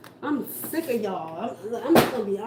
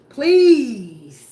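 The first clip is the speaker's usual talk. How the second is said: a loud, fed-up please; exasperated yell; 13 dB louder than usual. Real, not acted. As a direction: throw the word out, exasperated. Fed up with the reading.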